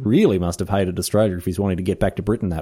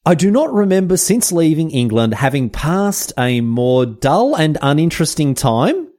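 higher, slower, solemn reading of the diary; reading Darwin's diary; 6 semitones higher than usual. Acted. Host reads Darwin's diary aloud to listeners, in a mock solemn higher voice. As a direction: intone Darwin's words with mock gravity. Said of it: "let's have a look through his diary".

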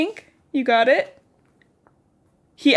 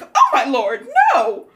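louder, higher mock-scandalized outcry; mock horror; 10 semitones higher, 5 dB louder, slower than usual. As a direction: exclaim as if scandalized, laughing slightly. Acting out horrified Effie.

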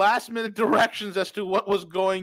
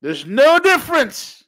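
high, loud, slow emphatic shout; 8 semitones higher, 8 dB louder, slower than usual. Real, not acted. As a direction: bellow the point slowly. Shouted then repeated quietly.

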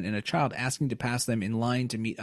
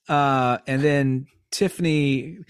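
ordinary delivery

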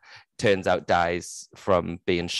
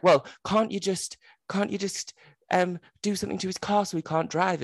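high, whiny daughter voice; mock daughter pleading; 11 semitones higher than usual. Daughter begging her mother for another way, high. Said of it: "then she's like".

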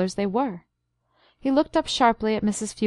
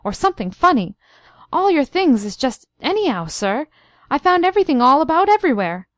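rising, ever louder flustered outburst; excited cry; 6 semitones higher, 6 dB louder than usual. A narrator's reading. Housekeeper tells her master his rooms were wrecked, excited cry. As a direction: spill it out in a breathless panic. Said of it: "she cried excitedly".

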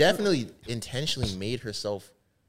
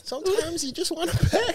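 high mocking voice. Repeating the line, teasing.

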